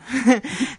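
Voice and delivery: short loud burst of laughter; bright laugh. Real, not acted. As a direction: laugh out at yourself. Laughs after saying any name.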